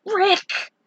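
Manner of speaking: high, loud cry of his name; cried out name. A narrator's reading. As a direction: call out to him high and loud. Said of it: "Tinsel cried his name".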